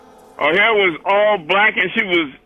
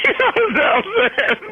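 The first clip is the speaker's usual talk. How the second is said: laughing; 11 semitones higher, slower than usual. Real, not acted. Laughing after his punchline.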